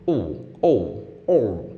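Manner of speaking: scratch with an R-coloured oh; scratched oh with a growly R tint; weird R scratch. Shown as a demonstration. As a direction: bend the oh toward er like a record scratch. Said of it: "It's kind of a weird R sound".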